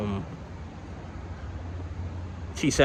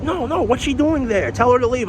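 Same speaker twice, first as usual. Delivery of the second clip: much higher, louder hurried no; exasperated supervisor; 13 semitones higher, 5 dB louder than usual. Retold or imitated. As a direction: brush it off fast, pitched up. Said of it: "He was like".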